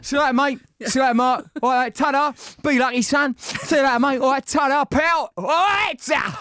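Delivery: high, loud market-trader cockney; cheery cockney barrow-boy send-off; cockney accent. Retold or imitated. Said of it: "I was getting so bloody cockney".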